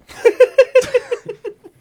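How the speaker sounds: loud, high burst of laughter; big laugh. Real, not acted. Teasing Chino about athletes he saw, loud high laugh. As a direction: crack up loudly at the joke. Joke about the older co-host's age.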